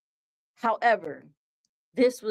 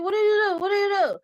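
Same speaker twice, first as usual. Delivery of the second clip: much higher, louder sing-song taunt; singsong cartoon voice; 11 semitones higher, 4 dB louder, much faster than usual. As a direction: chant it like a cartoon. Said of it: "He's like".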